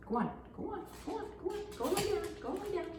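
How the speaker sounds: very high, light voice for shooing; high-pitched coaxing. Real, not acted. Instructor to an interrupting visitor, high-pitched coaxing. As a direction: coax a visitor out in a high little voice.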